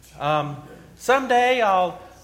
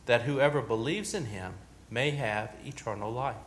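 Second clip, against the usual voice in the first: measured, reverent Gospel reading, lower and softer; solemn scripture reading; 4 semitones lower, 4 dB quieter than usual. Acted. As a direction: read the Gospel to the congregation with quiet gravity. Reads the Gospel aloud.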